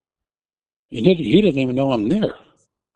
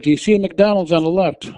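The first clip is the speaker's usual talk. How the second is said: higher, louder urgent question; urgent calling; 7 semitones higher, 4 dB louder than usual. Retold or imitated. Wife pressing her confused husband, raised urgent voice. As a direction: call it out to get through to him. Rousing husband in diabetic coma.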